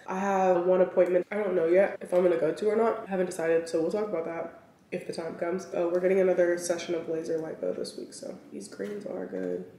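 ordinary talk, nothing marked

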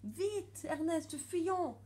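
high-pitched little mouse pleading; urgent pleading. A narrator's reading. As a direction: beg him to flee, high and pressing. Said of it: "supplie Célestine". (supplication pressante, voix aiguë)

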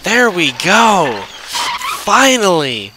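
loud, high victory shout; loud happy whoop; triumphant cheer. Real, not acted. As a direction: cheer it out at last. Triumph when pet joins.

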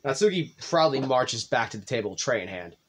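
normal talking voice

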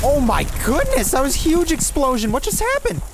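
high, stunned outburst; shocked. Real, not acted. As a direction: react to a huge blast. Huge explosion nearby.

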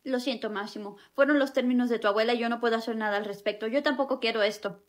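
apologising softly, nearly whispered; soft, sad apology. Acted. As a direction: say sorry quietly, with a heavy heart. Repeating breakup sadly. (disculpa triste y en voz baja)

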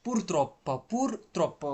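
"purtroppo" repeated carefully, holding the double p; pronunciation model. Shown as a demonstration. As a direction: say "purtroppo" as a drill, clearly split pur-trop-po. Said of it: "উচ্চারণ করেন একবার সবাই". ("purtroppo" স্পষ্ট করে ভেঙে উচ্চারণ)

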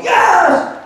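a high, loud Yes! shouted back; a bar crowd hollering Yes!; eager crowd yell. Retold or imitated. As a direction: yell it like a crowd that can't wait to hear. Crowd eagerly answering the singer.

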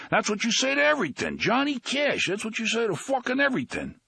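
high, fast, frustrated rant; fast high fed-up scolding; exasperated rant. Acted. Trix ranting at Henry.

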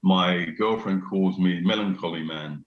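ordinary talk, nothing marked

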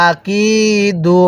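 slow "আকিদু" with a long held কি; drawn-out vowel. Shown as a demonstration. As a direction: stretch the কি in "আকিদু". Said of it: "এরকমভাবে পড়ব".

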